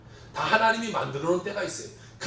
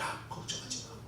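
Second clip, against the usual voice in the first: soft whispered comfort; gentle whisper; 14 dB quieter than usual. Retold or imitated. God comforting the scared missionary in a hushed whisper. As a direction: breathe it like a tender secret.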